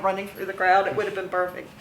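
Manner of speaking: ordinary talk, nothing marked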